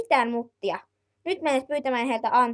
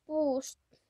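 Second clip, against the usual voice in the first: ordinary delivery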